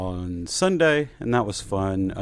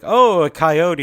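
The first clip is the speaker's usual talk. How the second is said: loud, higher mock-alarmed neighbor; 8 semitones higher, 12 dB louder, slower than usual. Mocking Nextdoor posters, loud mock alarm. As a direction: play the panicked neighbor spotting a coyote. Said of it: "it's like".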